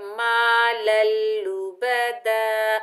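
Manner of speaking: slow, drawn-out chanted recitation; Quran recitation. Shown as a demonstration. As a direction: recite it slowly with tajweed. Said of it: "बगैर खींचे पढ़ना है".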